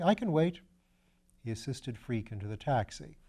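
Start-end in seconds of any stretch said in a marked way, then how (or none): 0.0-0.6 bright, higher, louder brush-off; breezy and obliging; cheerfully waving off the surgeon's thanks; cheery. A narrator's reading. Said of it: "said Parker cheerily".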